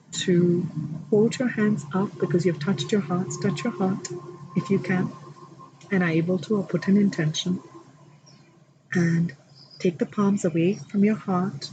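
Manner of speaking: calm, measured guided prayer voice; guided meditation prayer. Acted. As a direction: lead the room gently into prayer. Leads a guided blessing prayer.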